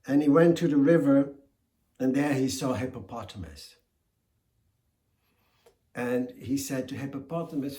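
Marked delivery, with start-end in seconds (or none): none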